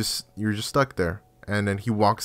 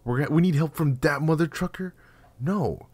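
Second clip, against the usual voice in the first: higher, outraged disbelief at the name; heated outrage; 5 semitones higher than usual. Real, not acted. Gamer told he needs Doctor Hayden's help, heated disbelief. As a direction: rant in angry disbelief, pitch climbing. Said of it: "I'm getting a little heated up".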